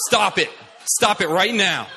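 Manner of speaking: loud, stern imitated yell. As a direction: yell a firm order to stop. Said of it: "She started yelling at her".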